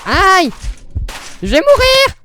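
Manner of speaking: high, loud yelp of pain and panic; panicked cry. Real, not acted. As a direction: shriek as the health drops. Taking damage in game.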